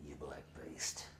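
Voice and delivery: low, voiceless menacing whisper; hissed whisper. Retold or imitated. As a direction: breathe the insult out low. Boss attacking the man.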